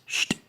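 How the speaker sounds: sht, a hissed reversed ch; backwards speech. Shown as a demonstration. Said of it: "play it backwards, it's".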